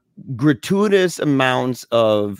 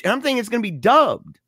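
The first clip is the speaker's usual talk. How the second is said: ordinary talk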